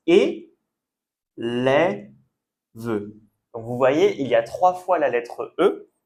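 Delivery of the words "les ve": "les, ve" as slow separate syllables of élève, open "è" then neutral "e"; differing E sounds. Shown as a demonstration. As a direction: pause between "les" and "ve", sounding each out. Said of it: "on prononce différemment". (élève découpé en syllabes)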